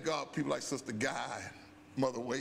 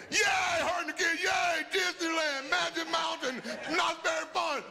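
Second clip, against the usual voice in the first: put-on excited kids cheering; 11 semitones higher, 3 dB louder than usual. Pastor imitating his kids cheering over California. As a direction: mimic the kids' delighted shouts. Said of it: "They just having a good time".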